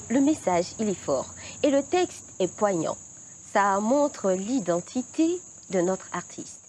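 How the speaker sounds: ordinary delivery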